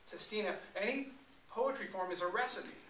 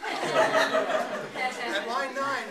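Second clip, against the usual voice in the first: much higher and louder, stretched out through laughter; real laugh on line nine; 6 semitones higher, 9 dB louder, much slower than usual. Real, not acted. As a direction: laugh as you hit it.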